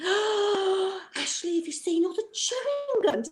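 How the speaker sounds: put-on high, amazed little kid; young child voice. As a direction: gasp it like a six-year-old. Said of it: "they look and go".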